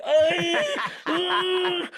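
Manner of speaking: high, loud screams of ah as the ride drops; loud panicked ah, ah on a swinging ride; terrified scream. Retold or imitated. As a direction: scream like you're about to fall off. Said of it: "I'm freaking out".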